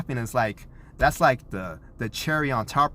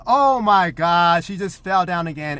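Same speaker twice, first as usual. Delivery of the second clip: comical mock alarm; 5 semitones higher, 6 dB louder than usual. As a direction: overdo the horror to get a laugh. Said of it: "started to get a little comical".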